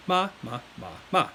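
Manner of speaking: four "ma"s, each with its own tone; four tones in order. Shown as a demonstration. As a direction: run "ma" through all four Mandarin tones. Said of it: "those are the four tones".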